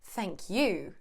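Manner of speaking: "Thank you." with the weight landing on "you"; stress on you. Shown as a demonstration. Teacher demonstrating, stress on "you". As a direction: push "you" to return the thanks. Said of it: "or just".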